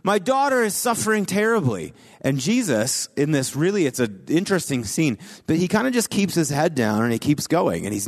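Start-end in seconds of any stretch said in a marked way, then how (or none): 0.0-1.9 pleading cry. Retold or imitated. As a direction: cry out to him for mercy. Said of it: "this woman starts lifting up her voice".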